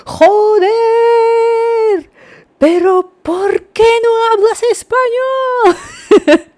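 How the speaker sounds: very high, loud mock scream, words stretched out; imitando gritos. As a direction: wail it like an exasperated shop assistant, hammed-up. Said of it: "gritaba así". (grito agudo y exagerado, imitando a la dependienta)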